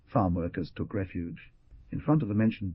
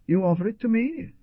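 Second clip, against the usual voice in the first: high, incredulous question; taken aback, rising sharply; surprised exclamation; 13 semitones higher, 4 dB louder than usual. A narrator's reading. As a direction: ask in surprise, pitch climbing steeply at the end. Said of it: "exclaimed the guerrilla in surprise".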